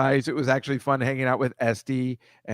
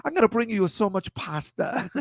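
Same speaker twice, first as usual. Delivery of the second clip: much higher, imitating an Italian waiter's accent; 10 semitones higher than usual. The waiter offering pasta at the table, a higher Italian accent. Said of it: "So he's like".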